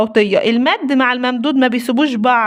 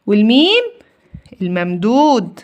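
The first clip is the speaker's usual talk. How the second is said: normal talking voice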